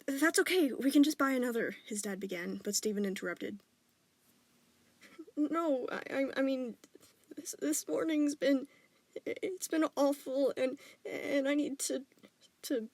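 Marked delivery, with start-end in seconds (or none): 0.1-1.8 reassuring. Acted. Dad reassuring son. 5.4-12.9 distressed stammer. Acted. Stephen distressed, breaking off.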